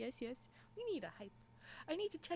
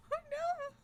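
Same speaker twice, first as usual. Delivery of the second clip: high squealed cry of alarm; mock alarm. Streamer reacting to a violent shake emote, mock-alarmed squeal.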